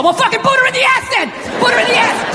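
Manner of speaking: mock rage, yelled high; angry inner voice. His angry side urging him to kick her, hammed-up high yell. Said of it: "just spitball".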